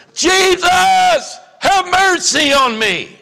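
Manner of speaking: high, loud desperate cry; yelling out. Retold or imitated. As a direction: shout it over a crowd. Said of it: "who's gonna start yelling".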